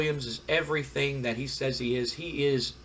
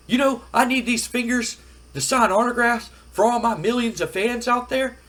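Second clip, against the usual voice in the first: high-pitched, emphatic acted boast; puffed-up wrestler, voice raised high; indignant self-important wrestler; 9 semitones higher, 4 dB louder than usual. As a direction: make a big indignant case of it. Acted wrestler grandly justifying himself.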